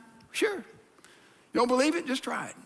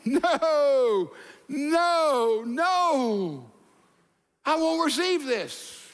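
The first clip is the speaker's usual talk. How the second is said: God's stern refusal; 7 semitones higher, much slower than usual. Retold or imitated. God rejects Cain's offering.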